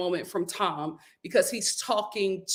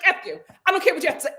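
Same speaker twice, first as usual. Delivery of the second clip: retold fury; 7 semitones higher, much faster than usual. Ariana cutting off Tom, higher angry snap. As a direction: throw it at him with contempt. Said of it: "Ariana was like".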